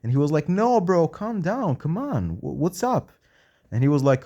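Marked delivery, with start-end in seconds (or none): 0.5-3.1 high-pitched appeal to calm down; retold pleading, raised and coaxing; placating plea. Man retelling how someone soothed a tense patient, high and placating.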